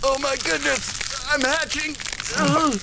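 high, strained and slow; dramatic hatching cry. Acted. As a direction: cry out in a high voice while cracking open. Dying king hatching open.

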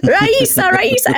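high yelled Russian calls; yelling in Russian. Retold or imitated. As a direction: call out to get her attention. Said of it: "yell and scream in Russian".

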